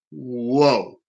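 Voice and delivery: low, awed exclamation; understated retold shock. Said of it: "He was even shocked".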